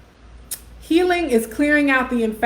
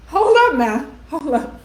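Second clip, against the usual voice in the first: amused disbelief, pitched up high at first; surprised hold up; 5 semitones higher, 3 dB louder than usual. Real, not acted. Host reacting to her sister's comment, amused surprise. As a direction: stop everyone, delighted by the line.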